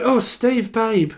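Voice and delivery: high, sugary mock fan voice; overfamiliar fan. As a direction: gush it like a stranger who thinks she knows him. Fans in chat overfamiliar.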